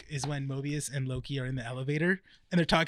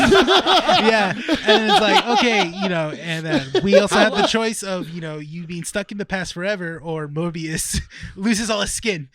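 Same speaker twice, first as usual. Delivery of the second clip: loud retelling broken by laughs; laughing while talking; 2 semitones higher, 8 dB louder than usual. Real, not acted. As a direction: talk through real laughter, louder at first. Cracking up while retelling the joke.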